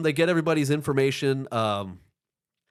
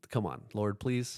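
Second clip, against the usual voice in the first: soft, pleading little prayer; quiet prayer; 6 semitones lower, 9 dB quieter than usual. Retold or imitated. Gym worker praying before checking sauna, soft plea. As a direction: beg the Lord quietly. Praying not to find activity.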